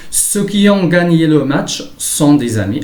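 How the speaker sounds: careful dictation pace, pitch lifted; example read-out. Shown as a demonstration. Teacher reads example sentence aloud.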